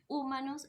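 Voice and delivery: "humanos" wrongly stressed on first syllable, HU-ma-nos; misplaced stress. Shown as a demonstration. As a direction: punch the opening "hu" of "humanos". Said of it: "no digo ni humanos".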